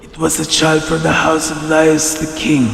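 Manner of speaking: put-on quiet, fearful old servant; hushed, reluctant old herdsman; timid old shepherd.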